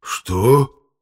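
loud, high shocked question; stunned. Acted. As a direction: blurt it out in disbelief. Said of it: "вытаращил глаза так". (ошеломлённо, громко)

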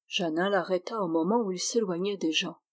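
ordinary delivery

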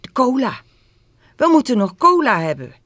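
high, loud, sudden exclamation; startled boy remembering something urgent. A narrator's reading. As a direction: blurt it out in alarm. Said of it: "tot hij plotseling geschrokken zei".

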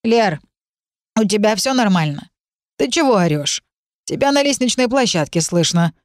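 ordinary delivery, nothing marked

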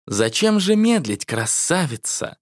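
slow, otherworldly voice from the void; unearthly creeping whisper-slow menace; eldritch horror. A narrator's reading. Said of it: "раздался потусторонний голос".

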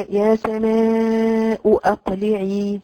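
يا سماء أقلعي recited very slowly and drawn out; teacher modelling يا سماء أقلعي slowly; slow recitation. Shown as a demonstration. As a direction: chant it like Quran recitation. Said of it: "مثلًا تقولي".

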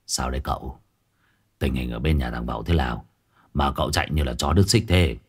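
low, soft worried asking; hushed question. A narrator's reading. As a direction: keep it hushed and wary. Old man asks, hushed. (hỏi nhỏ, thì thào lo lắng)

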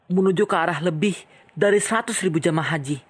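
plain reading voice

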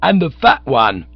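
loud, high soapbox rant; ranting orator. Acted. Bearded agitator denouncing Bertie to the crowd, ranting loudly. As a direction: harangue the crowd about idle rich. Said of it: "proceeded the chappie".

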